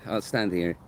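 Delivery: normal talking voice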